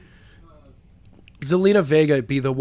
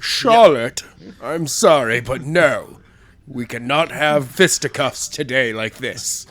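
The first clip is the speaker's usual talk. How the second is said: mock-formal British accent, slowing at the end; Queen's English accent; 2 semitones lower, slower than usual. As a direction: refuse politely in put-on Queen's English. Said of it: "like, the Queen's English".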